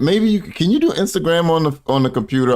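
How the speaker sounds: high-pitched disbelief; incredulous outburst. Real, not acted. Reactor challenging the no-phone excuse, incredulous. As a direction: blurt it out, baffled and high.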